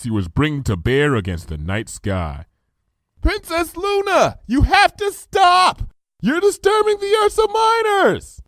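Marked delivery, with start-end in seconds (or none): 3.2-8.4 high, slow, loud warning; desperate high shouted plea; urgent shout. A narrator's reading. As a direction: yell to stop her.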